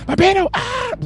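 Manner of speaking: high squealed yell; high mock scream. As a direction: scream it with the crowd. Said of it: "espero que no hayan gritado ustedes también".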